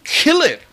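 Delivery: a sharp, high "Kill it"; emphatic kill it. Shown as a demonstration. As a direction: snap out "Kill it" high and hard. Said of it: "It's as strong as that".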